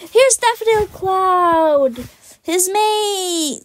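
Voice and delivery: high, slow, drawn-out introduction; gleeful announcement. Real, not acted. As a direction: present them in a happy high singsong. Announcing the next character happily.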